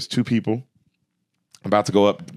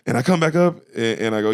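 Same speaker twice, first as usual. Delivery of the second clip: ordinary delivery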